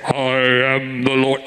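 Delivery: low, slow, booming voice of God. Retold or imitated. Preacher to congregation, voicing God in a low, slow boom. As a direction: let it boom slow and deep like God speaking. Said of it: "Oh, did you hear that?".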